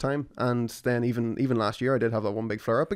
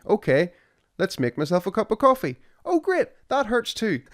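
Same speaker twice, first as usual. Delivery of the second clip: higher fake brightness turning sour; mock cheerful sarcasm; 9 semitones higher than usual. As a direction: sing it out falsely bright, then groan. Sarcastic coffee moment.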